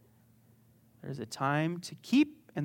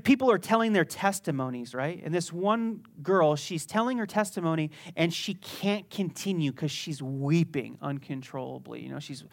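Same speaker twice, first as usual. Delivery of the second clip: ordinary talk, nothing marked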